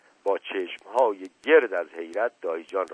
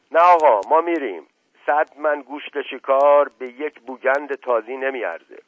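loud, higher, huffy refusal; proud refusal; 4 semitones higher, 8 dB louder than usual. A narrator's reading. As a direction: turn the offer down emphatically while leaving. Shoeshiner refuses and walks off. (امتناع بلند و دلخورانه)

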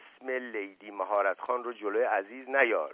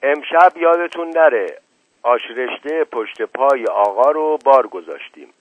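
wife calling out loud and high; wife reminds the guests about the soup, loudly; raised voice; 3 semitones higher, 8 dB louder than usual. A narrator's reading. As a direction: call it out across the yard to everyone. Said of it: "صدای زن دایجان بلند شد".

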